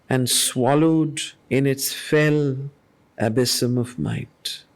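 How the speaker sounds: low, slow and solemn verse recitation; grave, deliberate reading of epic verse; poem reading. Acted. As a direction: let each line of the poem weigh heavy. Reads further verse lines.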